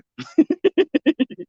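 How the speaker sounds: long, high-pitched giggle; real laugh. Real, not acted. Amused recalling yesterday's spider picture, high giggling. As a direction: let out a run of high giggles.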